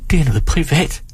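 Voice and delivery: ordinary delivery, nothing marked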